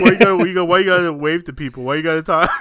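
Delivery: hammed-up jealous nagging; mock whiny complaint. Mocking the coworker who hates him waving, loud nagging imitation. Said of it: "that's basically what he's saying".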